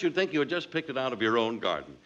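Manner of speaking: ordinary talk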